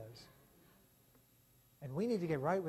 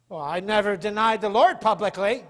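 louder, defensive protest; self-righteous excuse; 2 semitones higher, 12 dB louder than usual. Retold or imitated. Imagined churchgoer excusing himself, loud defensive protest. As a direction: defend yourself smugly. Preacher voices congregant excuse.

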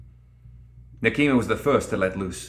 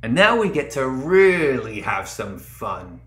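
put-on gleeful echoing boy; 3 semitones higher, slower than usual. The dreamer boy gloats over the battlefield, slow and gleeful. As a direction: savour each word like a grinning child about to play. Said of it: "The boy's voice echoed across the battlefield".

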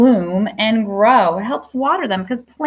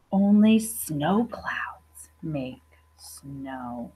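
slow, secretive whisper; 8 semitones lower, 6 dB quieter, much slower than usual. Acted. Teacher hushing a book line to her class, slow whisper. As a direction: breathe it like a secret to the kids.

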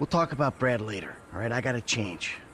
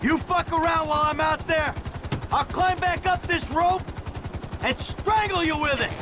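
higher, raised threat straight at Trevor; threatening shout; 12 semitones higher than usual. Acted. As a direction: yell the warning like you mean it. Threat shouted while rappelling.

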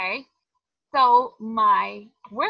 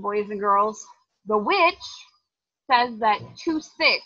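ordinary talk

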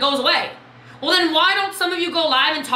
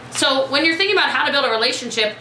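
ordinary talk, nothing marked

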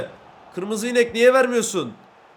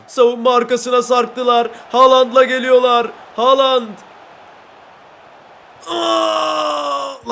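excited commentary; 4 semitones higher, 4 dB louder, slower than usual. Real, not acted. Attack then conceded goal.

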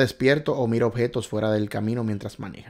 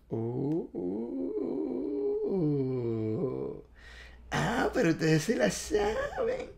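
high, soft, laughing through the words; real amused laugh; 8 semitones higher, 9 dB quieter, much slower than usual. Real, not acted. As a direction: giggle at how well they know it. Laughing at viewers' right answers.

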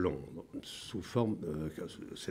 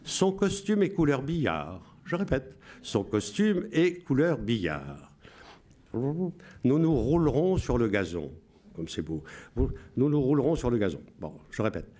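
solemn Radio Londres announcer; 3 semitones higher than usual. Shown as a demonstration. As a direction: read the coded messages like a BBC broadcaster. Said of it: "Je vous lis avec la voix".

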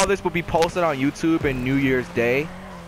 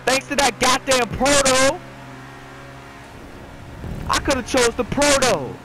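exasperated complaint; 8 semitones higher than usual. Real, not acted. Complains about losing.

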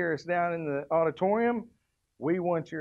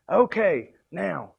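ordinary talk

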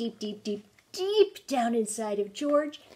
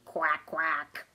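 duck quack; 7 semitones lower than usual. Acted. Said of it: "George went".

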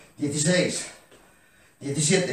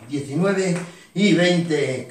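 drawn-out final count; much slower than usual. Shown as a demonstration. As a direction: pull the final count long like a coach finishing a set. Coach drags out final count.

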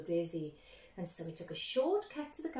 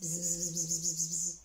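louder, lower buzzing like a bee; 4 semitones lower, 4 dB louder than usual. A narrator's reading. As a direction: buzz it like a busy bee.

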